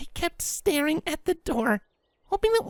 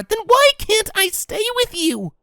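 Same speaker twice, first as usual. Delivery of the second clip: higher, louder small-voiced plea; pleading filly; 3 semitones higher, 6 dB louder than usual. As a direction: push back, hurt.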